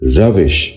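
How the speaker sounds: روش said "ravish", short "i" before the sh; correct pronunciation. Shown as a demonstration. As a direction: end روش on "-vish", not "-vash". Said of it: "آپ بھی اسی طرح دہرائیے".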